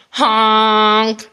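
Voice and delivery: long, held honk; drawn-out storybook honk; crying truck honk. A narrator's reading. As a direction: sound a stuck truck's horn. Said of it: "cried the dump".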